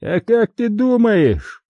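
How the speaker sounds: high, drawn-out gloating taunt; malicious triumph, pitched high; angry, triumphant. A narrator's reading. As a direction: sneer it slowly in a high voice. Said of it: "В голосе звучала злость и что-то ещё".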